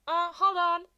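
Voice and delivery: put-on teen interrupting. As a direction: cut him off brightly. Midoriya interrupting.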